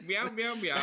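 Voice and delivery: playful cat meows; meowing. Pretend. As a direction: meow like a house cat. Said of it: "you do by meowing at it".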